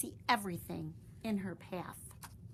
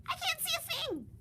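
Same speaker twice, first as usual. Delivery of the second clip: panicked bird cry; high frightened yell. Acted. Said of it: "yelled Pip".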